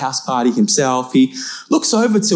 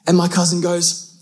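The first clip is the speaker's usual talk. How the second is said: ordinary talk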